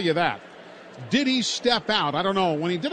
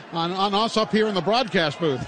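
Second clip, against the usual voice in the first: normal talking voice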